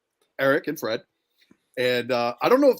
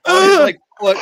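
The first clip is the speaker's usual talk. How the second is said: laughing burst; 14 semitones higher, 12 dB louder than usual. Real, not acted. Laughing while telling story.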